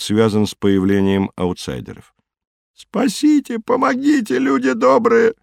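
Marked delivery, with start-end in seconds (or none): 2.7-4.4 high, plaintive wail; straining cry for help. A narrator's reading. Stranger begging to be saved, high plaintive cry. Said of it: "надрывался человек". 4.4-5.3 put-on high, tearful old-fashioned wail; whining plea. A narrator's reading. Stranger appealing to good people for help, high whining plea. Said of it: "плаксивое".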